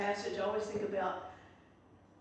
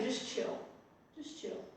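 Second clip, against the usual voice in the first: calm, hushed reassurance; 9 dB quieter than usual. Retold or imitated. Moses calming the grumbling people.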